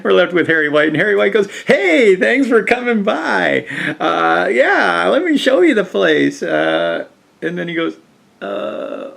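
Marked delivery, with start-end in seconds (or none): 1.7-6.4 higher, louder cheery greeting; a light mock of a hearty host; welcoming host. Host Harry greets arrivals.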